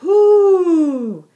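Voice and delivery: high, loud whoo and yays; excited cheer. A narrator's reading. Yvonne and Yimmy cheering the arrivals, high loud cheer. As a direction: cheer wildly at the visitors. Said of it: "yelled Yvonne Yak and Yimmy Yak".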